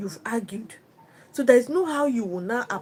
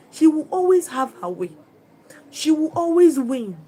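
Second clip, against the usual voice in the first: "always" loud and high, louder; stress on always; 4 semitones higher, 9 dB louder than usual. Real, not acted. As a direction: hammer "always" twice. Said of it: "preacher emphasises always".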